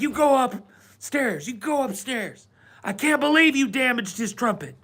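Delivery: high-pitched mock mom telling-off; scolding mother. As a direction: imitate a mother sending her kid upstairs. Retold mother spanking and scolding son.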